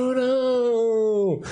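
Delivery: high, long drawn-out call; timber call. Acted. Host mimes felling a tree, timber-style cry. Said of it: "like chopping a tree down".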